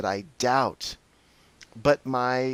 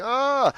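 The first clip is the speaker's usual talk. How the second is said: panicked cry; 13 semitones higher, 5 dB louder than usual. Retold or imitated. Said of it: "And I'm like".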